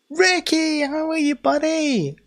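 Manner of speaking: very high, loud hello buddy; excited greeting. Real, not acted. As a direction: shout a happy welcome. Greeting a viewer.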